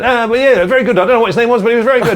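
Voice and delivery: booming, high host voice; loud compere voice. Retold or imitated. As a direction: announce it to the crowd. Compere praising him onstage.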